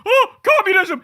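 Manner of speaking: high, loud mock gasp of alarm; squealed like a horror-movie scream; mock scare. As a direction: play a cartoon fearmonger. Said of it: "You go".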